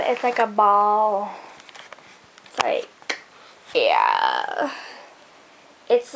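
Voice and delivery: slow, drawn-out mock product pitch; silly put-on voice. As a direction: stretch the words and pause between phrases, playing around. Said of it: "I don't know why I'm talking like this".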